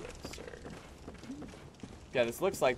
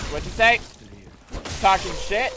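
loud, higher-pitched taunting yell; aggressive challenge; 7 semitones higher, 10 dB louder than usual. Real, not acted. Player challenging the enemies who mocked him, aggressive yell. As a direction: square up to the enemy.

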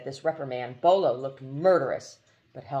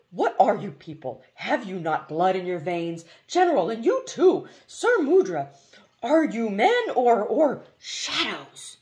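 indignant outburst; 6 semitones higher, 6 dB louder than usual. Acted. Bolo berating the others.

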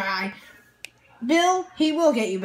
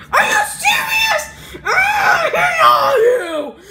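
very high, loud sobbing rant; crying angry shout; 11 semitones higher, 5 dB louder than usual. Acted. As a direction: scream it through tears. [name]'s put-on voice.

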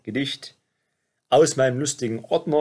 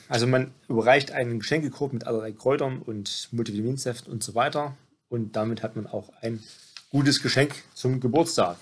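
ordinary delivery

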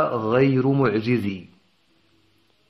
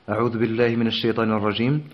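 Quran recitation. Shown as a demonstration. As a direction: recite the verse in measured, melodic chant. Teacher recites Quran verses.

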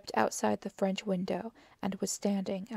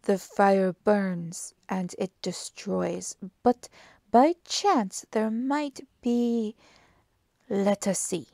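slow, deliberate detective thinking aloud; Poirot; 5 dB louder, slower than usual. Acted. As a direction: draw it out with suspenseful pauses.

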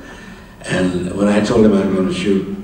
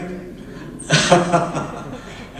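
breathy amused laugh at his own joke; 9 semitones higher than usual. Real, not acted. Old man laughing at his own story.

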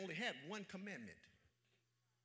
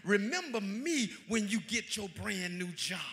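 building from loud to higher, louder shouted climax; preaching at full force; 4 semitones higher, 13 dB louder than usual. Real, not acted. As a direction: whoop it up to the peak.

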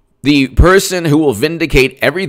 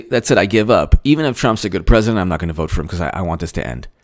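low, soft, fading resignation; mock giving up; 6 semitones lower, 3 dB quieter, faster than usual. Imitating voters who give up, low tired murmur. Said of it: "they just want people to say".

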